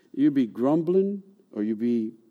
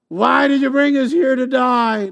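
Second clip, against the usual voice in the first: high, louder mock wail; hammed-up despair at the sea; panicked complainer; 10 semitones higher, 6 dB louder than usual. Israelites complaining at Red Sea.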